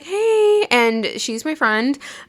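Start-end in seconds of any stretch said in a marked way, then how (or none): 0.0-0.7 bright high hey. Retold or imitated. Greeting her friend at the nail chair.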